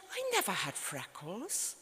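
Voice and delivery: lightly acted mother, soft with a swooping rise; indignant mother. Mother correcting the memoir to daughter, mildly indignant. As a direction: object softly as an affronted mother. Acting out mother's petty objection.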